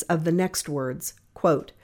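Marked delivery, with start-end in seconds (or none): none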